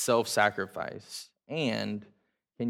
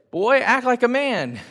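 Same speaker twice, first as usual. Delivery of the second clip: much higher and louder mock fatherly order; stern father; 12 semitones higher, 8 dB louder than usual. Dad ordering his son, a loud, high mock command. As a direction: bark it like a dad laying down the law.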